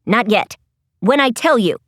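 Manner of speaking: low, firm command; mild shout. A narrator's reading. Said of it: "Lizzie shouted".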